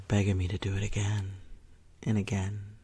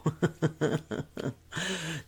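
breathy giggle; 5 semitones higher than usual. Acted. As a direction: giggle playfully. Playful laugh.

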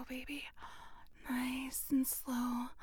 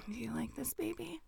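ordinary talk, nothing marked

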